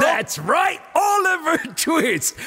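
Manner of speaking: high, drawn-out mock-British accent; hammed-up British, stretching the name. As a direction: crow it like a cartoon Brit. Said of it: "doing a British accent".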